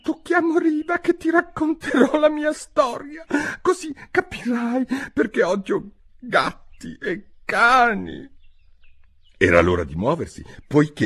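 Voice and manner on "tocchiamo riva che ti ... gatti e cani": much higher, tremulous, near tears mouse voice; trembling voice. A narrator's reading. The mouse tremulously promising Alice his story. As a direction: quaver it like a hurt little mouse. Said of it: "bisbigliò con voce tremula".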